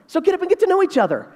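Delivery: high, quick urging to get up; lightly voiced uncle hurrying the guests along; uncle addressing guests. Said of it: "Uncle Mitch says".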